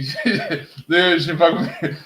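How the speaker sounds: laughing through the words; amused laughing disbelief. Real, not acted. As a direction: breaks into real laughter mid-sentence. Laughing about being trolled.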